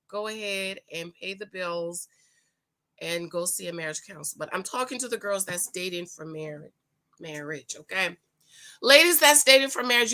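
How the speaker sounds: ordinary delivery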